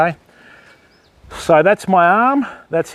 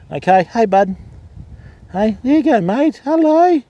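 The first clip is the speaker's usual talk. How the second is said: cooing pet talk; 8 semitones higher than usual. Real, not acted. Keeper coos at the cockatoo in a high baby voice. Cooing at bird after it lunged.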